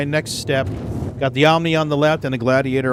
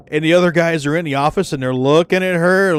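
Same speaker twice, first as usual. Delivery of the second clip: ordinary talk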